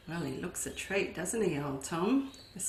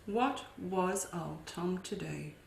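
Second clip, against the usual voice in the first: usual speaking voice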